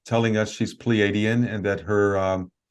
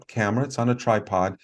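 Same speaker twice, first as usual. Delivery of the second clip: normal talking voice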